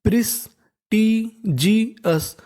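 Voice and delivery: Prestigious drawn out very slowly with a falling end; teacher sounding out prestigious for the class; slow syllable by syllable. Shown as a demonstration. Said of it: "तो meaning बन गई".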